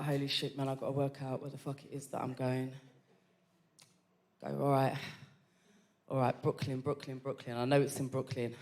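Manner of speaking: very low, hushed inner monologue; muttering to self. Retold or imitated. Lost woman trying to find her way, muttering to self. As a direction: mumble it privately while working things out. Lost, talking to herself outside.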